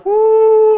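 loud, high exultant "woo"; joyful whoop. Real, not acted. Preacher thrilled by scripture, loud whoop. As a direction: whoop for joy.

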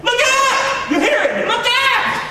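loud, very high preacher's yell; high-pitched shouting. Acted. Preacher urging congregation to stop looking at the wind, high shouting.

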